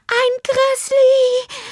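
sehr hoher, gedehnter Angstschrei; zitternde Stimme. A narrator's reading. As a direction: kreische langsam und laut vor Entsetzen. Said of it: "sagte sie mit zitternder Stimme". (entsetzt, schrill und langgezogen)

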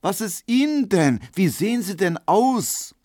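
high-pitched astonished questions. A narrator's reading. Markowski acting shocked at Kubinke's looks, astonished. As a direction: swoop up in theatrical concern. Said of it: "rief Markowski erstaunt".

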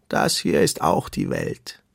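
low, slow turtle voice; deep-voiced turtle. A narrator's reading. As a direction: state it low and unhurried. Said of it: "eine tiefe Stimme".

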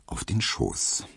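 ordinary delivery, nothing marked

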